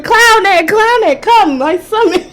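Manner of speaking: high, loud summoning scream. Retold or imitated. Calling out to Clown X around the house, a shrill scream. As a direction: yell the name into the house. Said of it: "I was walking around the house screaming".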